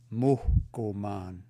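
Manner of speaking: "Muhkuman" slow, with an audible puff of H before the K; aspirated H. Shown as a demonstration. Teacher showing learners the aspiration, slow and exaggerated. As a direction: break "Muhkuman" after a breathy "Muh", then draw out the rest. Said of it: "When you see that H, make this sound".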